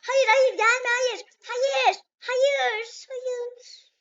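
loud, panicked cries of no; panicked screaming. Real, not acted. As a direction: scream in real fright as it comes at you. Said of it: "bağırdığım için özür dilerim". (panikle bağırarak)

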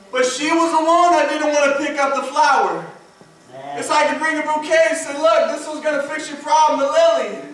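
high, loud, urgent pulpit cry; full-force preaching. Acted. Preacher recalling how he guided her to the one, full-force preaching. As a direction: drive each phrase out loud and high.

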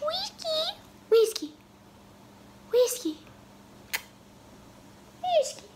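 high cooing pet voice; high sing-song calling to a pet. Real, not acted. Calling and cooing to her cat.

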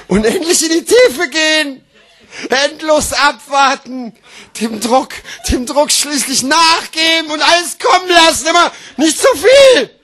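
high declaiming that breaks into shouts; strained exclaiming. Acted. Slam poet mimicking toilet pressure in verse, strained shouting. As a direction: push each phrase out with mounting strain. Poem bursts back into pressure.

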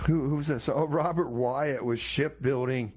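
normal talking voice

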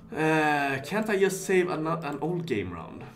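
ordinary talk

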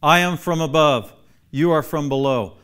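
loud, emphatic declaration; authoritative. Acted. Teacher quoting Jesus to the class, authoritative. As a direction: proclaim it with firm certainty. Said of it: "that's pretty authoritative".

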